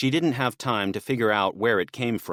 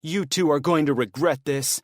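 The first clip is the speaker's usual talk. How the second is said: venomous, raised threat; spitting anger; 6 semitones higher, 3 dB louder than usual. Acted. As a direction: spit the threat out at them. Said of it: "Ed spat at them".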